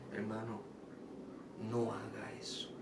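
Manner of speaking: a soft plea to stop; soft warning. Retold or imitated. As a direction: quietly tell him to stop. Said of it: "le dije".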